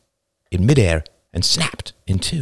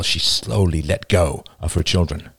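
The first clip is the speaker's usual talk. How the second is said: plain reading voice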